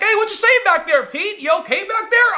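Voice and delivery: high, loud guide's voice; calling back. Retold or imitated. Tour guide checking on Pete behind him, calling out. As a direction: call out to someone behind you.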